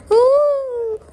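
high spooky ooh; ghost noise. Shown as a demonstration. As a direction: moan like a ghost. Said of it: "I just made a ghost noise".

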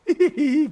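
high, loud burst of laughter. Real, not acted. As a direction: cackle at the screen. Laughs at game moment.

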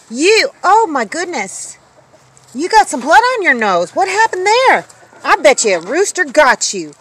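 high, loud, swooping concern; fussing over a pet. Real, not acted. Owner finds blood on the dog's nose, loud fussing surprise. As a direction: exclaim over the dog, pitch leaping up.

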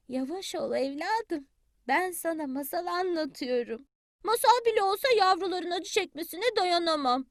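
ordinary delivery, nothing marked

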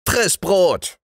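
high, curt angry retort; yelling wife. A narrator's reading. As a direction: fling it back crossly, high-pitched. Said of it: "schrie die Frau". (zornig schreiende Frau)

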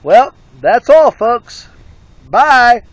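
loud, high cartoon-style goodbye; mock cartoon farewell to the viewers; cartoon sign-off. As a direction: belt out the sign-off like a cartoon ending.

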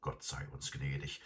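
quiet private prayer of a worried farmer; to himself. A narrator's reading. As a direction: say it softly under the breath. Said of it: "sagte er zu sich selbst".

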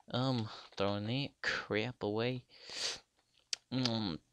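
normal talking voice

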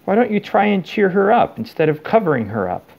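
put-on a fed-up girl lecturing the elves; exasperated. A narrator's reading.

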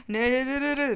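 alarmed cry. Real, not acted. Gamer crying out as he slips down again. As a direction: yelp in dismay.

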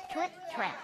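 hushed little trip trap; quiet echo for the class; soft trip trap. Shown as a demonstration. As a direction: softly sound out trip trap. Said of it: "Say it".

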